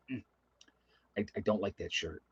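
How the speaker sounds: mock disgusted mom. Son imitates mom disliking the shirt, low disgusted murmur. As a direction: mimic mom's look of disgust in a murmur. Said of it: "She'd be like".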